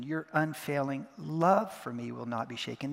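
"love" held long and emphasized; stress on LOVE. Shown as a demonstration. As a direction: dwell on "love", then finish evenly. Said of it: "taking it one word at a time".

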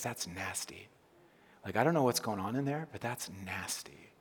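mock disgust. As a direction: murmur it as if something smells off. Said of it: "He's like".

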